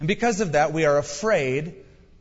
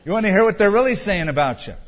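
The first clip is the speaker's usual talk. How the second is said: loud teasing punchline; 5 semitones higher, 6 dB louder, faster than usual. Real, not acted. Punchline to congregation.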